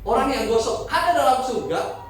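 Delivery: normal talking voice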